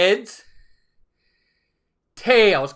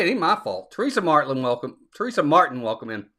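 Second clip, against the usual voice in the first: normal talking voice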